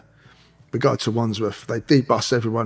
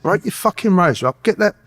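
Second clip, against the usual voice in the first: insistent, higher-pitched command; urgent repeated order; 6 semitones higher, 3 dB louder than usual. Retold or imitated. Prisoner repeats himself to a baffled cellmate, urgent and insistent. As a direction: press the order home, pitched up.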